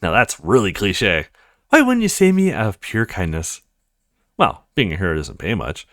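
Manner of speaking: normal talking voice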